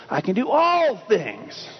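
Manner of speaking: slow, high "all" drawn out; stress on all. Shown as a demonstration. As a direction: proclaim "all" slowly. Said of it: "Place that emphasis wherever you need to".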